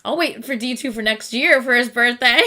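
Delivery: ordinary talk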